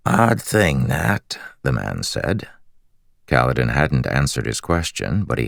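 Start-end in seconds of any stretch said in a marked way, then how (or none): 0.1-1.4 lightly raspy, friendly prisoner; a hint of rasp, curious and chatty; raspy slave voice. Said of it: "He had a raspy voice".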